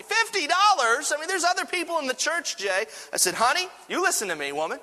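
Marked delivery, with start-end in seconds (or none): 0.0-2.8 very high, loud mock outrage; outraged wife. Wife scolding her husband over the offering, outraged. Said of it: "And she was mad". 3.3-4.8 raised, hammed-up bossy retort; mock stern husband. Husband pretending to boss his angry wife, mock stern. Joking retort in acted quarrel.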